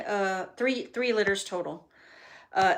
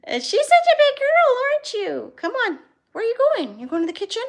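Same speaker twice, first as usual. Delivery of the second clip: doting dog talk; 7 dB louder than usual. Real, not acted. Owner asking her big dog where she's going, high doting baby talk. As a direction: coo high at a beloved pet.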